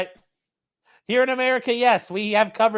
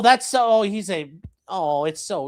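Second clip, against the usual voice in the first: ordinary talk, nothing marked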